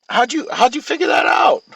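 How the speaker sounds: high, loud, exaggerated wonder; mock amazement. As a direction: play up fake amazement. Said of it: "I'm like".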